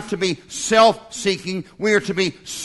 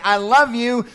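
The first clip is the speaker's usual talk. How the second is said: higher, louder, earnest declaration; spouse saying I love you; 5 semitones higher, 5 dB louder than usual. Acted. Spouse speaking to their mate, higher earnest declaration. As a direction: say it like you mean it to your mate.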